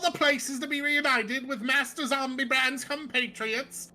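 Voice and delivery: put-on British accent. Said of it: "I gave him a British accent".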